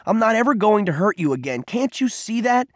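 high, insistent appeal; exasperated, earnest protest; urgent pleading. A narrator's reading. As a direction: implore him to understand, pitch rising and slowing at the end. Cole implores Peter amid blows.

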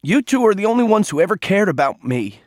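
blurted. A narrator's reading. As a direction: let it spill out all at once. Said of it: "he blurted".

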